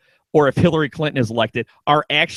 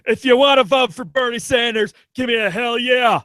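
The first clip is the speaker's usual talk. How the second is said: higher wrestler promo voice; Stone Cold impression; 7 semitones higher than usual. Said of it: "What if Stone Cold comes out".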